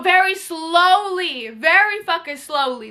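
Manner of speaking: very slow, drawn-out emphasis; dragged out. Real, not acted. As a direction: stretch each word to show the slowness. Drags words describing slow car.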